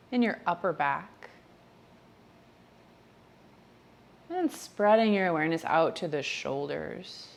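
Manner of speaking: gentle, measured meditation voice, spacious phrasing; guided meditation. Real, not acted. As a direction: ease them through each body part, softly and unhurriedly. Leads a body-scan meditation.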